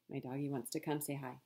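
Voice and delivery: ordinary delivery, nothing marked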